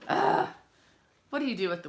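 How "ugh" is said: exasperated groan. Real, not acted. Son won't wave on camera.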